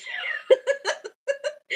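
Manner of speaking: delighted high-pitched giggle; high squealing laugh. Real, not acted. Delighted at sister's reaction.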